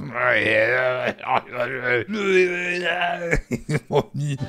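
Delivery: long shrieking laugh. Real, not acted. Reacting to the drunk man.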